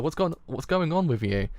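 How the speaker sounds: ordinary delivery, nothing marked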